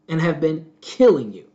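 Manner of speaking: normal talking voice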